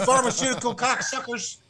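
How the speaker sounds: high mock sneer; imitating the customer; mock angry customer. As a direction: spit it like the irate buyer.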